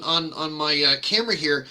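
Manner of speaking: ordinary delivery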